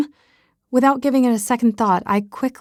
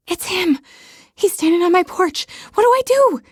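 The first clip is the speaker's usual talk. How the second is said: panicked; 10 semitones higher than usual. Acted. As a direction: blurt it out in a frantic rush of alarm. Sees Brent at the door, panics.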